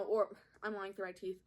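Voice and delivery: normal talking voice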